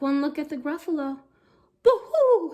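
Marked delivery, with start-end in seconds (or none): 1.8-2.5 high loud owl hoo; owl hoot. Acted. Owl fleeing the Gruffalo, high hoot. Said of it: "he said".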